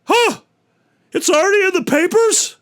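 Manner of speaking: very high, loud shocked squeal; horrified lion. Acted. Lion learning his shame is in the papers, shrieking. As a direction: squeal in mortified panic. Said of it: "the lion goes".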